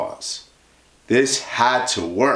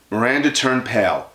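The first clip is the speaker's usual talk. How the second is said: ordinary delivery, nothing marked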